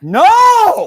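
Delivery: high, loud joyful scream; ecstatic shout. Retold or imitated. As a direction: scream it with disbelieving joy. Retold overjoyed reaction to role.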